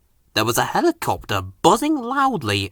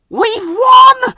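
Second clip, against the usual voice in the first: much higher, loud triumphant shout; gasped shout; 8 dB louder than usual. Acted. Fireman announcing the win, high and loud. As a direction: burst out with the news. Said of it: "he gasped".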